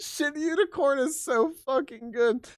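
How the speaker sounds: high, giggly praise through laughter; laughing delight. Real, not acted. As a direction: gush while still giggling. Still laughing at TTS.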